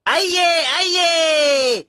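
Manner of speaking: high, drawn-out vendor's call. Acted. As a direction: call customers over, stretching the word. Vendor calling out to customers. (ग्राहकों को पुकारते हुए)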